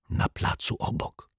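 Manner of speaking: hushed secretive tip-off; whisper. A narrator's reading. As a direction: whisper it without voice. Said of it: "szepnął szyld". (konspiracyjny szept)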